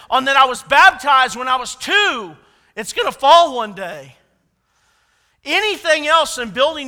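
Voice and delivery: loud, driving sermon delivery; fervent preaching. Real, not acted. Preacher warning the church, at full force. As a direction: preach it hard to the room.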